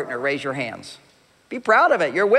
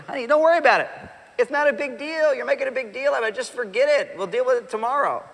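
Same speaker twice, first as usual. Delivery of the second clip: dismissive husband; 6 semitones higher than usual. Retold or imitated. Husband brushing off upset wife.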